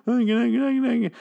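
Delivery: high, rising nagging voice repeating Maggie; mocking pleading. Mocking the characters, a whiny high plea repeating Maggie. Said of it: "And they're like".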